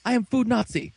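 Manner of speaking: high-pitched, hammed-up refusal; mock anger. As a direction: scold like a mad cook in a retelling. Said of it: "Doc's all mad".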